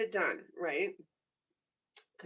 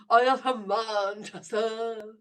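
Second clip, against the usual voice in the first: slow, louder put-on British question; mock British accent; 5 dB louder, slower than usual. Quizzing a viewer on their town, mock British. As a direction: ask it like a Mancunian. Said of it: "Am I saying it correctly?".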